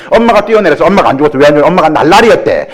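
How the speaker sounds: ordinary talk, nothing marked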